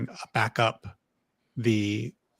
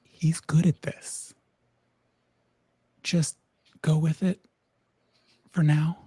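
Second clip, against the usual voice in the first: whispering; 5 semitones higher than usual. Acted. Fawn pulls others aside.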